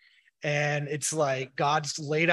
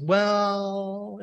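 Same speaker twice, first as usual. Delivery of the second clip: long drawn-out, higher "well"; pastor's stalling answer; 5 semitones higher, 4 dB louder than usual. Retold or imitated. Pastor answering a child's hard question, stalling. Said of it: "My pastor said".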